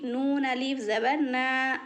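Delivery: ordinary talk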